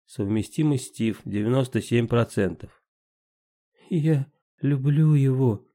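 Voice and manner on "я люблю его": slow, shaky near whisper; trembling whisper. A narrator's reading. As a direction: hold back tears. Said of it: "дрожащим голосом прошептала Мирта".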